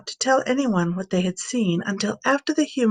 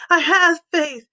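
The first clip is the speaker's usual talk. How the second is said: a higher, louder desperate cry; father cries out; 7 semitones higher, 5 dB louder than usual. Acted. Father crying out to Jesus to help his doubt, higher and louder. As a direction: cry out your faith to him. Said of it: "the boy's father cried out".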